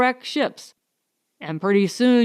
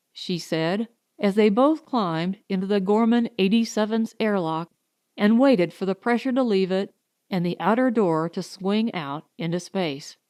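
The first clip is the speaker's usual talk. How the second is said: plain reading voice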